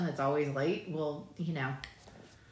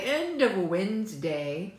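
slow, deliberate "end of Wednesday"; careful retry; 3 dB louder, slower than usual. Real, not acted. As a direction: get "Wednesday" out slowly and whole. Retrying the phrase after stumbling.

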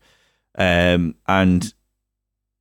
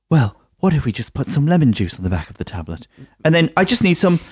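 higher-pitched, hammed-up children's TV acting; children's TV treasure hunter; 7 semitones higher than usual. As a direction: play it like a cheesy children's show. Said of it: "if B B C wanted to do a child, um, treasure hunt".

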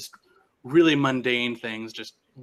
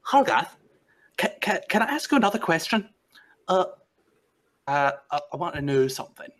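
Nyctis eagerly asking; 6 semitones higher, faster than usual. Acted. Nyctis pestering Hargath with questions, high and stammering. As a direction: stumble over the words in eagerness. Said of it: "he's like".

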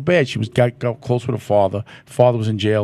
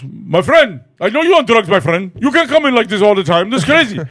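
high, loud imitation of an African shopkeeper; African accent; 8 semitones higher, 5 dB louder than usual. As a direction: put on an African accent and go higher and louder. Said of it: "The African goes".

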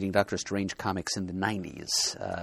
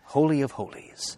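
slow, higher, portentous proclamation; grand mock-solemn announcer tone; chill-inducing intonation; 6 semitones higher, 4 dB louder than usual. Shown as a demonstration. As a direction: intone it like a sacred title, drawn out. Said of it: "I got chills".

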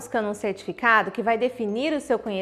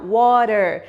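"water" in an American accent, flapped t and a strong final r; 4 semitones lower, 6 dB louder than usual. Shown as a demonstration. Teacher showing the American "water", louder, final r sounded. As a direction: say "water" the American way, ending on a hard r. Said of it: "um americano fala R no final".

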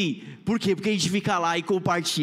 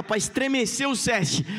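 usual speaking voice

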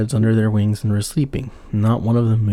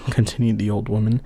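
usual speaking voice

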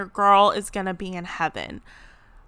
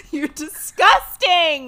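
high, loud, slow mock disgust; 7 semitones higher, 6 dB louder, slower than usual. Real, not acted. Daughter teasing her dad, loud and grossed out. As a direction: stretch it out, teasing.

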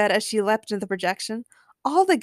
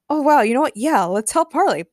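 hasty, slightly louder agreement; said quickly; 5 semitones higher, 5 dB louder than usual. A narrator's reading. Dylan gives in to Harley, quickly. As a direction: agree in a hurry. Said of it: "Dylan said quickly".

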